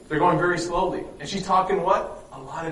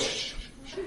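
quick mock shush; hushing shh; 14 dB quieter than usual. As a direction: imitate people hushing each other. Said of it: "they're like".

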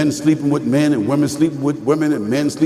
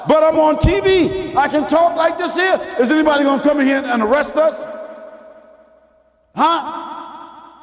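preaching shout; 12 semitones higher than usual. Real, not acted. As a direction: proclaim it boldly to the crowd.

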